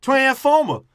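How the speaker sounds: loud, high mock Boston accent; hammed-up Boston accent, blurted high. Mocking the actor's accent in the movie, exaggerated loud Boston. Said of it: "his Boston accent".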